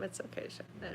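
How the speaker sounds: soft soothing whisper. Real, not acted. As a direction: whisper gently to calm the pig.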